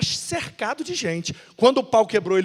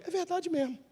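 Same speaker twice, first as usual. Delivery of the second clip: Barnabé manso; 9 semitones higher, 10 dB quieter than usual. Acted. Barnabé resignado.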